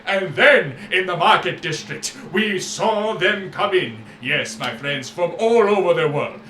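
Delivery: booming showman proclaiming to the crowd; grand merchant voice. Acted. Merchant Syrah booming to the crowd in a grand voice. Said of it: "he's talking in these big, huge moments".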